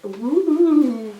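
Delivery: a sudden high, loud ooh at the flames; surprised yelp; startled ooh. Retold or imitated. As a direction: jump back from the fire. Flames in her face.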